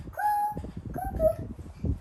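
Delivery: very high cooing owl call. Retold or imitated. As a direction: coo up high like a little owl. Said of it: "and she says".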